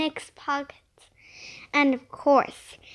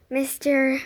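usual speaking voice

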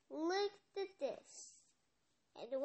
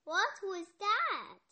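startled cry; 5 semitones higher, 6 dB louder than usual. Acted. Startled by the knocking.

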